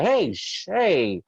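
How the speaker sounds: comic panicked calming; mock hushing dad.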